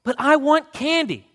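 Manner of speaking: put-on whining boy. A narrator's reading. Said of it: "He whined again".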